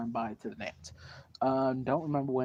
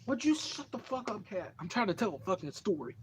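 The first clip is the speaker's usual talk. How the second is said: much higher, exasperated snap at the cat; annoyed at cat; 7 semitones higher, 3 dB quieter than usual. Real, not acted. Teller interrupted by a noisy cat, scolding it in a high exasperated voice.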